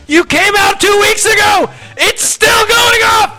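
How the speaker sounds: high, drawn-out exasperated shout; yelling over alarm. Retold or imitated. Repairman's customer on the phone by the alarm, yelling over it. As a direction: holler each word slowly over a blaring alarm. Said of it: "I have to yell over it".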